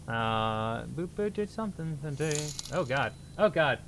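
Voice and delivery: ordinary talk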